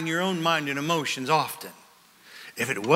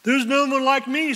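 higher, proud boast; lightly voiced Adam; 7 semitones higher than usual. Adam boasting about himself, a light mock boast. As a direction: crow it with pride, lightly. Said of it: "said Adam".

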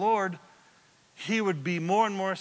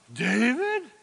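raised, louder questioning call; startled call back; 5 semitones higher, 5 dB louder than usual. Acted. Saul turns at David's hail, questioning shout. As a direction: call the name out in surprise across a distance. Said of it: "and then Saul goes".

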